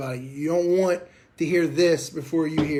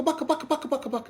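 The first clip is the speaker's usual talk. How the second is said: mock bucka calls; 10 semitones higher, faster than usual. Shown as a demonstration. Instructor mocking a goose that never varies, high bucka chant. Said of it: "he doesn't bucka".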